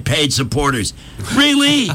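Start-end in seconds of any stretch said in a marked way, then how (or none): 1.3-1.9 high mock disbelief; incredulous. Real, not acted. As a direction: shriek of disbelief. Incredulous reaction.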